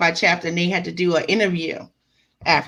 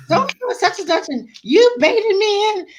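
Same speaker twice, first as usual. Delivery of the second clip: complaining reader; 12 semitones higher than usual. Retold or imitated. Beta reader scolds the author, high whiny voice. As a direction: whine it up high like a betrayed reader. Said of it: "She was like".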